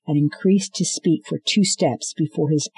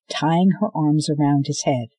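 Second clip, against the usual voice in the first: ordinary delivery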